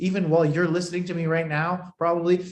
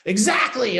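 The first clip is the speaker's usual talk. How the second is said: slowed, louder mock exclamation; mock shouted word; 7 semitones higher, 4 dB louder, slower than usual. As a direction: punch it out like a shouted headline. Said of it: "as if I'm randomly shouting words".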